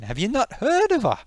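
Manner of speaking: high-pitched Geordie disbelief; indignant question. Acted. Geordie challenging someone who hasn't heard, indignant. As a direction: ask it incredulously, pitch leaping.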